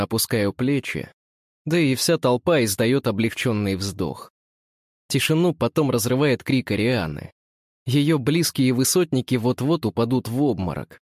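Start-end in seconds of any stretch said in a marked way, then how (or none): none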